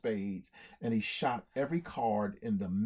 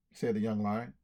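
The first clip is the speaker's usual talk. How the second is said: ordinary delivery, nothing marked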